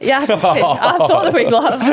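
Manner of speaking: high, loud, laughing reply. Real, not acted. Coach joking with the hosts about the wig, laughing. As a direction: laugh through the words, voice shooting up.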